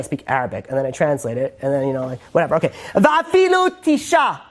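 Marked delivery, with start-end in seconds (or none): 2.9-4.4 slow, high chanted Hebrew; drawn-out Talmud chant; Hebrew recitation. Shown as a demonstration. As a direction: intone the Hebrew text slowly, like study-hall chant. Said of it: "You should listen in Hebrew".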